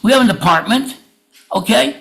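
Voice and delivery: ordinary talk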